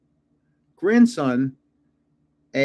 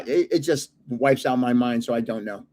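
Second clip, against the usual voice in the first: ordinary talk, nothing marked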